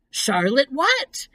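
high, drawn-out rising question; keen, curious storybook pig; eager question. A narrator's reading. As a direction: ask eagerly, stretching toward the last word. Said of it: "asked Wilbur eagerly".

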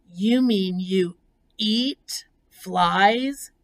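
slow, aghast question rising at the end; shocked gasp. Acted. Pig questions the spider's diet of flies, aghast. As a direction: draw it out in stunned disbelief. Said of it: "gasped Wilbur".

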